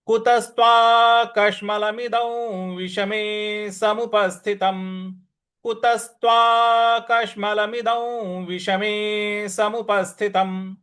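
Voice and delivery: slow, measured chanting of the verse; full line recitation. Shown as a demonstration. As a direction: recite the whole line slowly. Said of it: "तो पूरी पंक्ति क्या बन रही है?".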